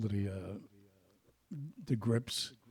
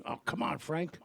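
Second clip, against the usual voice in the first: pleading protest; 7 semitones higher than usual. Retold or imitated. Guardino pleading against drinking.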